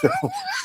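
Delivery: high, breathy real laugh; sudden high laugh; real burst of laughter. Real, not acted. Laughing at his own story.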